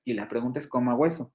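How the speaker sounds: ordinary delivery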